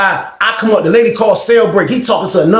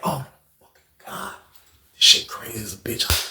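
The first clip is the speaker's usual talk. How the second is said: whispered disbelief, much softer; hushed "oh my God" at a crazy sight; shocked whisper; 9 semitones lower, 11 dB quieter than usual. Retold or imitated. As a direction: breathe it out in stunned disbelief. Shocked reaction to the scene.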